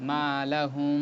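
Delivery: মা লাহুম with the মিম held nasally, light; nasal ghunnah recitation. Shown as a demonstration. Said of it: "গুণনার সহিত পাতলা করে পড়তে হয়". (গুণনা সহ তিলাওয়াত)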